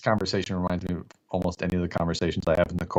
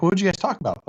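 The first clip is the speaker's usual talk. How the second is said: much higher, curious friend; pitched up, eager question; 11 semitones higher, 4 dB louder than usual. Retold or imitated.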